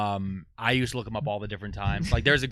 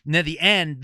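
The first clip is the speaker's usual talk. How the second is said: normal talking voice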